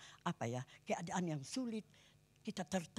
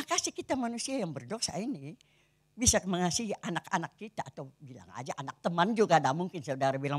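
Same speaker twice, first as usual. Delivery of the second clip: ordinary talk, nothing marked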